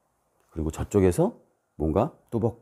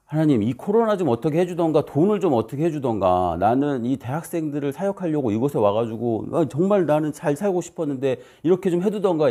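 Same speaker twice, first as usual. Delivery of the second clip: higher-pitched pleading complaint; acted grumbling prayer, pitched up; re-enacted desperate prayer; 6 semitones higher than usual. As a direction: plead with God in frustration. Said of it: "이렇게 기도하고 있었을 때".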